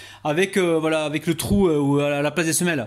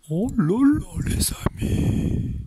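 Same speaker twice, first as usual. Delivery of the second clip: amazed; 6 semitones higher, much slower than usual. Real, not acted. Marvelling at the finished dish.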